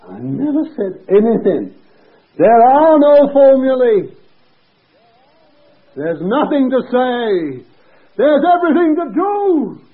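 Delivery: high, loud, drawn-out proclamation; full-force preaching. Real, not acted. Preacher declaring the lesson of a healing, impassioned shout. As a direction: preach it at the top of the voice.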